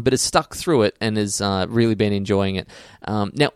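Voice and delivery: normal talking voice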